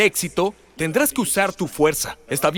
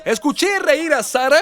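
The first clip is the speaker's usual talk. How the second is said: higher, louder call out; mock divine call; 11 semitones higher, 6 dB louder than usual. The Lord telling Abraham he heard Sara, loud and high. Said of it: "el Señor dijo".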